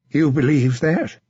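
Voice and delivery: lightly voiced young knight asking in doubt; a hint of a wary squire; a young knight, unsure; doubtful young knight. William questions Sir John.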